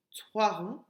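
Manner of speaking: ordinary talk, nothing marked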